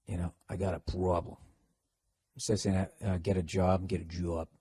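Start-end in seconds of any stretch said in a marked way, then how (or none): 0.5-1.4 low, quiet Boston accent; Boston-accented aside. Shown as a demonstration. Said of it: "the Boston accent kinda came back". 3.2-4.5 low, soft Boston accent demo; practising Boston accent under the breath. Shown as a demonstration. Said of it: "working on your Boston accents".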